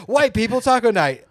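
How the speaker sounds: high, loud hammed-up announcing; exaggerated high, loud delivery; mock meme caption voice.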